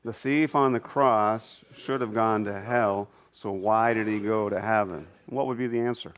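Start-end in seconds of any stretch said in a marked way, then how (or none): 0.0-5.1 slow, measured phrase by phrase; deliberate, evenly spaced group recitation; say-along recitation. Shown as a demonstration. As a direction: lead a group in reciting it slowly. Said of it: "you wanna say it with me?".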